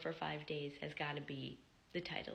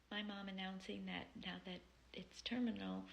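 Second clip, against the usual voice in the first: usual speaking voice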